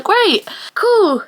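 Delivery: high, whiny sarcastic "great, cool"; mock cheer with a whine; sarcastic squeak of despair. Sarcastic reaction to awful people.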